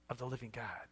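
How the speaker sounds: hushed, breathless "of the living God"; whisper. Real, not acted. Pastor savoring Peter's confession, whispered. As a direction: let it drop to an awed whisper.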